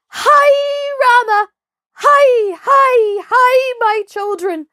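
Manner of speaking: high, loud calls over the herd; shouting commands. Acted. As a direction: yell across the plain to drive the cattle on. Herd-boy driving the stampeding buffalo.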